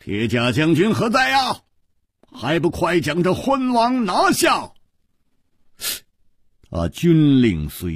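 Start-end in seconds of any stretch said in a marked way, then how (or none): 0.0-4.7 high, harsh general's order; stern shouted command. A narrator's reading. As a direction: call the guards out sharply, voice raised in pitch. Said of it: "闵将军厉声喝道". (厉声喝令)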